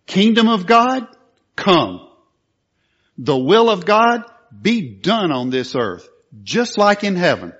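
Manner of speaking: loud, commanding proclamation; forceful declaration. Shown as a demonstration. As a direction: declare it like taking hold by force. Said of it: "And that's the forcefulness".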